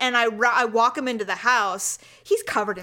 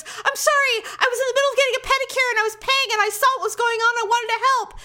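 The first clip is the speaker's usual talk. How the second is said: much higher, fast, breathless apologetic chatter; pedicure lady; 11 semitones higher, faster than usual. Retold or imitated. Retelling the pedicure lady's apology, much higher and fast. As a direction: rattle it off like a flustered neighbor. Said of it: "She goes".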